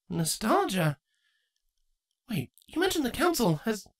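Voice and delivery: put-on young mare lifted high and light; Twilight's voice. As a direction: voice Twilight, gently doubtful.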